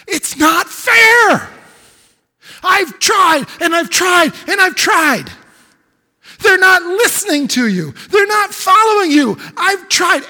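high, loud shouted complaint, pleading and bitter; pent-up complaint. Acted. Elijah pouring out his heart to God, shouting. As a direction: let it all burst out at once.

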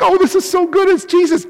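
lightly voiced, high happy cry; mock delighted disciple. Disciple glad to see Jesus, mildly mock delighted. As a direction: play it as the joy they didn't feel. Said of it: "They're not like".

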